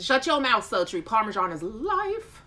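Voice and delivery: mock outrage. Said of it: "Twin says".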